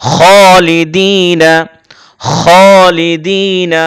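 'খালিদিনা' very slow, opening on rounded 'kho'; heavy kh. Shown as a demonstration. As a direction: recite 'খালিদিনা' as long 'khoo-li-dii-na'. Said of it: "খা উচ্চারণ করা যাবে না".

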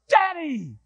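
high, drawn-out falling cry; family's cry. Acted. Kids react to Dad's plan, high drawn-out cry. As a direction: call out high, stretching the word downward.

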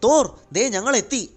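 high, loud hail from afar; calling out loudly. A narrator's reading. Spidey hails Thor as the team arrives, calling loudly. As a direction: shout the name to get attention, pitch way up. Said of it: "Spidey ഉറക്കെ പറഞ്ഞു".

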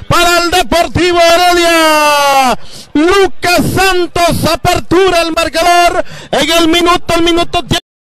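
high, loud excited commentary; shouted goal call. Real, not acted. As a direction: keep yelling the scorer's name. Goal announcement still shouting. (narración de gol a gritos)

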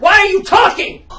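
loud, high outburst; angry shout. Acted. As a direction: lose it at her. Director exploding at actress.

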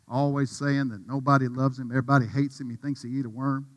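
usual speaking voice

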